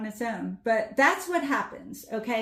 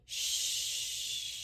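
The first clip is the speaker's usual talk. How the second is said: a soft voiceless shh; shushing; 5 dB quieter than usual. Shown as a demonstration. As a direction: hush someone softly. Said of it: "shushing somebody".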